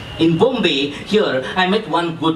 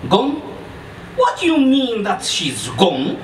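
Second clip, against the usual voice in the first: high-pitched incredulous stranger; outraged questioning in a put-on voice; angry disbelief; 4 semitones higher than usual. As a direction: demand an explanation, pitch jumping up.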